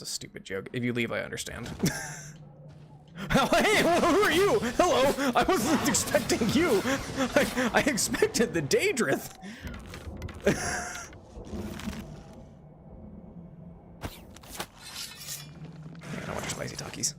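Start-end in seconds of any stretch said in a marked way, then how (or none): 3.4-9.3 surprised. Real, not acted. Surprised greeting to monster. 16.3-17.1 whisper. Pretend. Whispered joke line.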